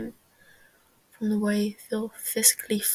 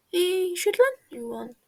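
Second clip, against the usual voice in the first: teasing; 8 semitones higher, 5 dB louder than usual. Acted. Wilbur teasing Techno.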